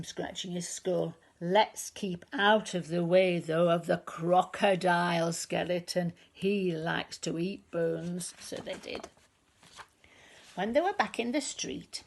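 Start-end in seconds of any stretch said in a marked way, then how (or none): 1.4-8.3 lower, slow big skeleton voice; a hint of a slow lumbering skeleton. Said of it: "The big skeleton said".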